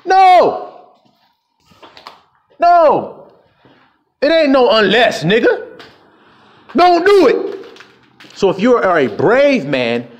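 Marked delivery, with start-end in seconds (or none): 0.1-5.8 shout. Real, not acted. Preacher shouting down a quote. 6.8-7.7 forceful command. Real, not acted. Preacher commanding.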